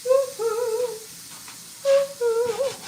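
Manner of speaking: high sung woo-hoo; playful high cheer. Real, not acted. Excited about the cheese.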